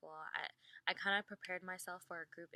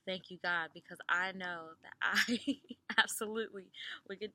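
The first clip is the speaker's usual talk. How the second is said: louder, laughing mock relief; relieved gush; 10 dB louder than usual. Answering the kind interviewer, loud laughing relief.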